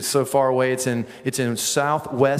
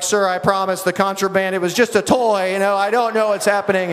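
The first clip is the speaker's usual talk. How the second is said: higher-pitched, comic panicked pleading; mock panic; 7 semitones higher, 4 dB louder than usual. Hiker pleading with Border Patrol, comic panic. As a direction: plead your innocence in a hammed-up panic.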